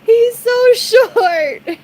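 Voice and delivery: high, very slow, laughing hard; high squeal of laughter; laughing quote. Retold or imitated. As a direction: stretch it out helplessly laughing. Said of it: "I was like".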